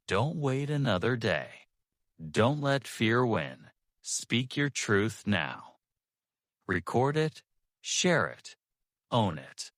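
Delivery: ordinary talk, nothing marked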